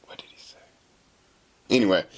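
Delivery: usual speaking voice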